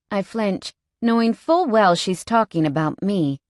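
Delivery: usual speaking voice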